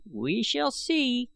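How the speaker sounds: put-on slow, high old man's pronouncement; ominous old man. A narrator's reading. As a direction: say it slowly, full of hidden meaning. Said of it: "stated I Gos".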